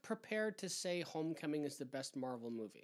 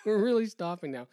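loud, higher insistence; louder, punched-up sign-off; emphatic repeat; 5 semitones higher, 10 dB louder than usual. Real, not acted. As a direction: announce it firmly over the laughter. Repeats it more forcefully over laughter.

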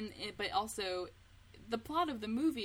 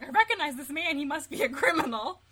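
mock panic; 7 semitones higher, 10 dB louder than usual. Acted panicked movie character.

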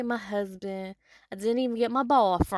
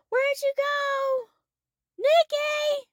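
put-on high, long call to a lost child; mother calling her wandering child, high and loud; 5 dB louder, much slower than usual. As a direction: call across a field, worried. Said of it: "you call him".

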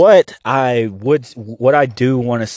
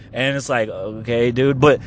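ordinary delivery

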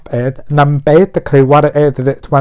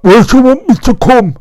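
much higher, louder put-on voice for the client; mock client voice; 9 semitones higher, 4 dB louder than usual. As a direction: squeak the question out like a caricature. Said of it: "obviously not like that, 'cause he's from Australia".

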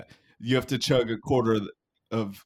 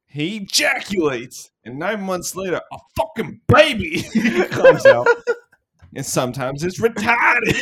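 higher, louder Boston teacher, laughing through it; Boston sex ed teacher; 9 semitones higher, 6 dB louder than usual. Retold or imitated. Host acting out the bit as a Boston teacher, laughing. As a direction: push it up into a cracking comic teacher voice. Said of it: "Boston teachers teaching sex ed".

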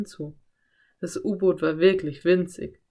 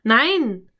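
loud, higher, appalled refusal; aghast exclamation, sharply raised; horrified cry; 4 semitones higher, 7 dB louder than usual. A narrator's reading. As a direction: blurt it out in alarm. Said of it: "rief Philipp entsetzt".

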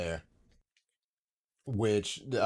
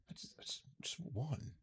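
hushed, pleading whisper; 3 semitones higher, 18 dB quieter, slower than usual. Real, not acted. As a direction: breathe it out softly. Hushed plea for one lotto win.

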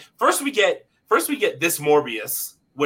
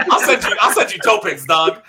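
laughing; 5 semitones higher, 5 dB louder than usual. Real, not acted. Joking offer of toe pics.

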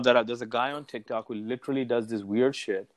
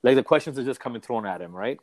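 ordinary talk

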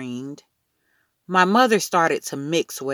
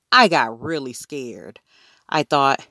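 ordinary delivery, nothing marked

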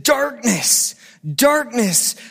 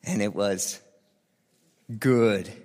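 solemn scripture reading; 6 semitones lower than usual. Acted. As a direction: read it low and reverent, like a poem. Reads Genesis one as poem.

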